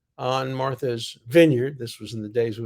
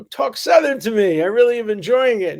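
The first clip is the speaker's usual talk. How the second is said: bright, higher, louder playful request; imitating Clinton; 9 semitones higher, 6 dB louder than usual. President asking the Southern wife to keep talking, playful and higher. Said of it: "he was saying to her".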